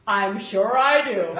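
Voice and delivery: slow, laughing sarcasm; sarcastic disbelief. Retold or imitated. To the officer she thinks is a prank, slow sarcastic laughing reply. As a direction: drag it out like you don't buy it.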